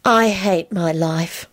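slow, resigned deadpan. A narrator's reading. Soaked sister telling her brother, slow and resigned. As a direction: drag it out in weary resignation. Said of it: "in a resigned voice".

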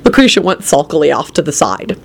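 plain reading voice

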